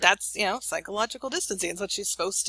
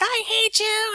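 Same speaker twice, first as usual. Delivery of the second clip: much higher, louder bratty I hate you; teen tantrum; 6 dB louder, slower than usual. Retold or imitated. Daughter yelling at mom before slamming door, higher, louder.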